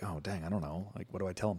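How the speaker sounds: a stumped father muttering under his breath; dad's worried aside. Retold or imitated. Stumped dad muttering to himself about his son, softly. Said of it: "so the dad's like".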